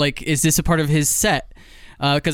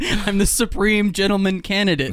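put-on whiny kid voice; 5 semitones higher than usual. Mock Biden voice continued.

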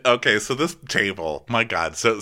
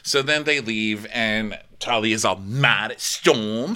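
ordinary talk, nothing marked

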